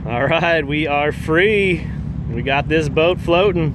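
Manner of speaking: loud, thrilled cheer as the boat floats; excited. Real, not acted. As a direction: call it out with real excitement.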